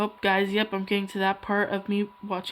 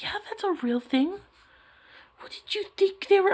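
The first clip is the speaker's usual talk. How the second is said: lightly voiced mom, higher; amused mother answering her daughter; a hint of a teasing mom; mom's voice; 10 semitones higher than usual. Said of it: "She's like".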